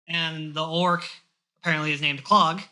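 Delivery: ordinary talk, nothing marked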